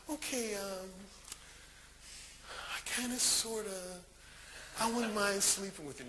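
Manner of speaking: halting and soft, with long pauses; mock-hesitant, edging into each phrase; imitated reluctance. Woman giving in to his ultimatum, retold hesitant murmur.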